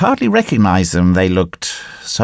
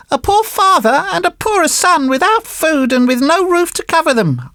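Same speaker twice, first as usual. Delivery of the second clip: high-pitched puppet voice, appealing; pleading marionette. Acted. Marionette asks for shelter at the door, high pleading voice. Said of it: "answered the marionette".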